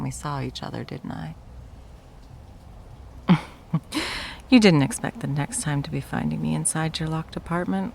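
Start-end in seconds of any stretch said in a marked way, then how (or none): 3.7-4.4 breathy mocking laugh. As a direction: laugh at their shock. Villain mocks shocked victim.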